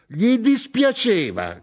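very high, loud, drawn-out echo; outraged shout. A narrator's reading. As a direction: cry the words back in disbelief. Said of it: "gridò mia madre".

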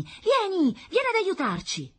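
very high, eager calling; child's cry. A narrator's reading. Daughter calling father to come help, high and excited. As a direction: call for help in a little girl's voice. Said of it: "gridò Rita per tutta risposta".

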